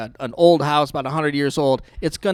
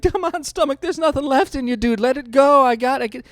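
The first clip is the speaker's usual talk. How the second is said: higher mock pleading; pleading with stomach; 9 semitones higher, 5 dB louder than usual. Pleading with his stomach while vomiting, mock pleading. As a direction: beg your stomach to let go. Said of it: "you're pleading with yourself".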